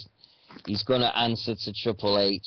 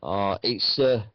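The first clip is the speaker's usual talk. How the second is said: ordinary talk, nothing marked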